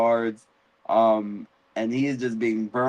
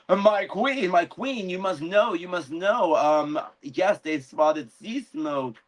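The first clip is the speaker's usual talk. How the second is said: higher, breathless herald voice; urgent messenger; 6 semitones higher than usual. Retold or imitated. The messenger bursting in to warn the queen, in a higher urgent voice. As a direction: rush in pleading for her attention.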